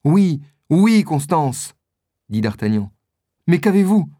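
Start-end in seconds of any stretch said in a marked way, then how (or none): 0.0-1.8 high, loud eager answer; raised-pitch, anxious assurance; urgent reply. A narrator's reading. D'Artagnan reassures dying Constance urgently. 3.5-4.1 raised, louder anxious question; high, urgent concern; alarmed question. A narrator's reading. D'Artagnan alarmed at Constance's state.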